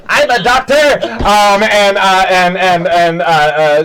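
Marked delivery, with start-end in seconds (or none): 0.1-0.7 high, loud goblin announcement. Acted. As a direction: call out eagerly in a high goblin voice. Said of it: "a goblin runs up and goes".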